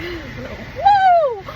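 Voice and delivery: sudden high whoop; excited woo. Real, not acted. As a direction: let it burst out high and loud. Excited release mid-story.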